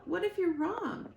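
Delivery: put-on high, fast, worried young voice; a little bird panicking about his mother; anxious gosling. Gosling Bright Bill pleads.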